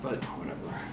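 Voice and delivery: ordinary talk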